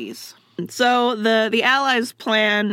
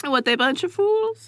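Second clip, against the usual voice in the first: mocking Germans; 6 semitones higher than usual. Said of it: "makes the Germans think".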